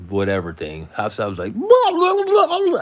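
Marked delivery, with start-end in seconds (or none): 1.5-2.8 mock yelling in a very high squeak; squeaky yelling guy. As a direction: squeal the gibberish like the angry driver. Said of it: "I didn't know his voice was like that".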